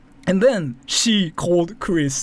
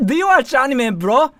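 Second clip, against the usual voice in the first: much higher and louder, laughing through a hammed-up fan voice; mock anime fan greeting; 9 semitones higher, 7 dB louder than usual. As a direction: play an overeager weeb greeting his senpai. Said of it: "surprise you with how fluent my English is".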